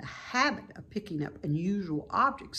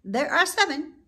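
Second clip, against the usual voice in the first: goose; 10 semitones higher, 5 dB louder than usual. A narrator's reading. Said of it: "said the goose".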